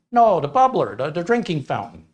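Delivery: put-on high, insistent Wisconsin accent; loud start, settling into explanation; exasperated Midwest local. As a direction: correct them impatiently in a put-on Midwest accent. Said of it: "within a certain region speak a certain way".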